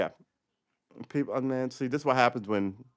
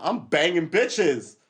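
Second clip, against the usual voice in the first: braggart; 6 semitones higher, 7 dB louder, much slower than usual. Retold or imitated. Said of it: "one guy's talking about".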